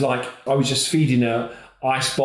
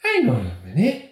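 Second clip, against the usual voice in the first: much higher, delighted recognition; excited midwife; 12 semitones higher than usual. Retold or imitated. Midwife gushing at the celebrity dad. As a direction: gush about recognising him. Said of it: "she goes".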